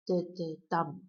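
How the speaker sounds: two light "da"s, then stressed "dum"; weak-weak-strong beat, "da da DUM"; anapestic stress. Shown as a demonstration. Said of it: "emphasis on the last syllable".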